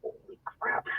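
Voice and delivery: breathy whispered holy crap; soft shocked whisper to herself; whispered aside. Retold or imitated. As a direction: hush it, stunned under your breath. Stunned aside after cop's rule.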